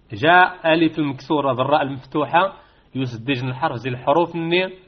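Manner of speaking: ordinary talk, nothing marked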